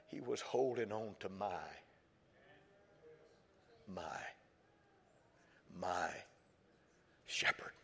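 slowing and fading into a whisper; hushed fading. Acted. Preacher retelling the boy's dying words, fading to a whisper. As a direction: let it die away to a whisper.

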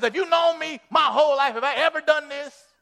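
an offended donkey calling out its loyal record; indignant donkey. Retold or imitated. Donkey scolding the prophet who beat it, indignant shout. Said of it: "the donkey says".

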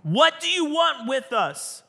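high, loud cry of protest; screamed outburst. Acted. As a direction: cry out like a tormented spirit. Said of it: "this voice from the middle".

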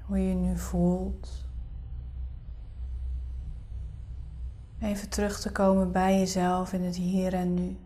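soft spa whisper. Acted. Leads a guided meditation.

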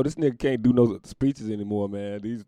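ordinary delivery

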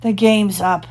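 usual speaking voice

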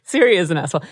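ordinary delivery

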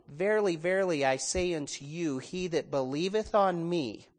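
slow, solemn reading of scripture. Acted. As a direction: read it low and reverent. Reads scripture aloud.

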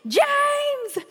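high-pitched call for help; frantic mock yell to a husband; retold yelling. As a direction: re-enact yelling his name, drawn out high. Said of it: "I'm yelling at James".

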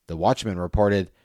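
plain reading voice

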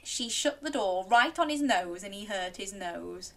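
ordinary delivery, nothing marked

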